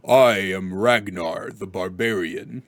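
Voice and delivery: very low, slow barbarian; e-boy putting on a deep voice to impress; morning voice. Shown as a demonstration. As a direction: drop deep and slow, mock-manly. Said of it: "doing his Morting voice".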